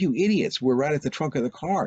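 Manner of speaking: high, sharp mock outrage; exasperated insult. Brother scolds the girls in a high exasperated voice. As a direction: throw it at them, pitched high.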